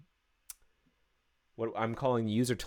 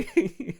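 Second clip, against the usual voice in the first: delighted laugh; 9 semitones higher, 7 dB louder than usual. Real, not acted. Laughing after success.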